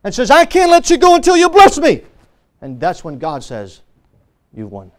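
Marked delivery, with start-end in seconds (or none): 0.3-2.0 high, raised-voice desperate plea; preacher acting Jacob's urgent begging. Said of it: "after Jacob begged him". 4.5-4.9 very low, soft pronouncement; quiet, grave concession after the shouting; hushed verdict. Acted. God's answer after Jacob's loud begging.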